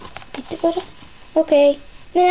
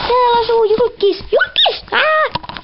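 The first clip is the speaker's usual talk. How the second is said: excited shout; 8 semitones higher than usual. Acted. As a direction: shout it for the whole town. Shouting at every door. (kovaa kiljuen)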